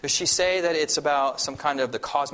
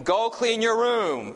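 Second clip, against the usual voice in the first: higher, louder, slow order; louder and serious; 10 semitones higher, 3 dB louder, slower than usual. Shown as a demonstration. Parent ordering a child, louder and more serious. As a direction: raise the volume to sound serious. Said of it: "If you increase the volume".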